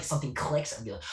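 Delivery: ordinary talk, nothing marked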